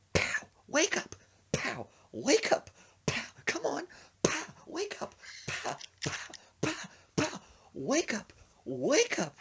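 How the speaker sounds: high, urgent wake-up calls between slap noises; urgent rousing. Retold or imitated. Slapping a fainted friend awake, acted urgent calls. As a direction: punctuate mock slaps with high-pitched pleas.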